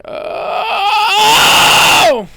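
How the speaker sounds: very high pushed scream; piercing fry scream; screeching falsetto scream. Shown as a demonstration. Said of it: "So you're going".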